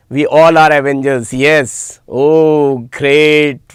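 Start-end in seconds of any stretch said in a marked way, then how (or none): none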